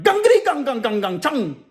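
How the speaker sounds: high, ringing nonsense-language imitation; mock foreign gibberish. Inmate pranking the new officer, high mock gibberish. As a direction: rattle off pretend words in a high put-on voice. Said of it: "and the dude spoke".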